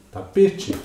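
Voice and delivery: "tapete" pitched high on PE; stress on middle syllable. Shown as a demonstration. Teacher repeating the example for students, "tapete" stressed on PE. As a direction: lift PE sharply in "tapete". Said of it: "a sílaba com destaque".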